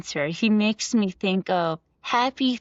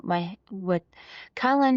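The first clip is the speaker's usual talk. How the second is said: normal talking voice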